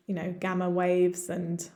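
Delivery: ordinary talk, nothing marked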